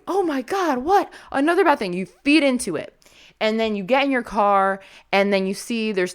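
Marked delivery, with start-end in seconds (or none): 0.1-1.9 much higher, whiny disbelief; mock exasperation. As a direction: play the fed-up victim of a bad day. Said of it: "It, it sprays at you".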